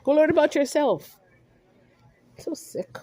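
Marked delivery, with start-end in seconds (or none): 2.4-3.0 breathy whisper of disgust; a hushed, disgusted aside. Real, not acted. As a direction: whisper it under your breath. Muttered aside during rant.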